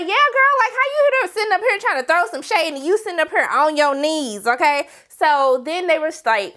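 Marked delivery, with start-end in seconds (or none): 0.0-4.9 sassy agreement. Retold or imitated. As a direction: pile on the shade. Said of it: "And I was like".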